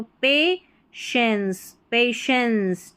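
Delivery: "पेशेंस" slowly as pay-shens, with SH for TI. Shown as a demonstration. Teacher correcting the spelling trap, "पेशेंस" slowly with SH. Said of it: "इसको श pronounce करना है".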